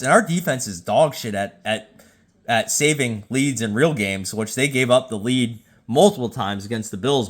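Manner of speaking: ordinary delivery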